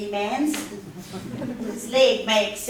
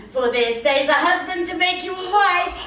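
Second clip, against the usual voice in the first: loud, laughing announcement; laughing shout; 6 semitones higher, 4 dB louder than usual. Acted. Nurse announces the wedding.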